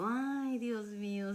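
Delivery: grito agudo de alivio; aliviada. Retold or imitated. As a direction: exclama aliviada, mucho más aguda. Alivio al oír español. (Ay Dios mío, grito agudo y alto de alivio)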